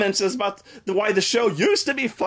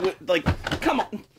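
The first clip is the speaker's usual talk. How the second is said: normal talking voice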